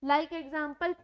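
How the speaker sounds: ordinary talk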